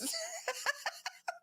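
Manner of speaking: high, breathy burst of laughter; laughing. Real, not acted. Amused at cilantro being taken for weed, laughing. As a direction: crack up in high little bursts.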